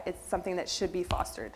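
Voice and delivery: usual speaking voice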